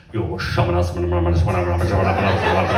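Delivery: low, rapid monotone chant; mock-fervent babbled incantation; speaking in tongues. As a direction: chant it like a mock faith healer. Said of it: "she'd, like, speak in tongues over me".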